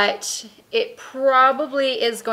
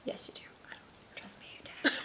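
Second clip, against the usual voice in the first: insistent whisper; 16 dB quieter than usual. Real, not acted. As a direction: whisper it firmly, like a secret. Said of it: "Why are we whispering?".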